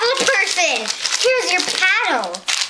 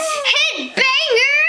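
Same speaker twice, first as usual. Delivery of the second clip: slow high drawl; 7 semitones higher, slower than usual. Pretend. Playful slow chant.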